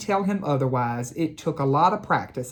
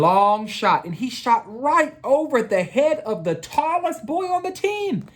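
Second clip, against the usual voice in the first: usual speaking voice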